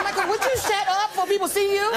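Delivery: high, urgent telling-off; exasperated plea. Retold or imitated. Chris tells Michael to shut up, exasperated. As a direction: beg a noisy friend in a high voice. Retold hushing of noisy friend.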